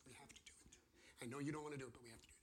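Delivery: softly insist, like a calm surgeon; gentle God voice. Retold or imitated. God answering the suffering believer, hushed reassurance.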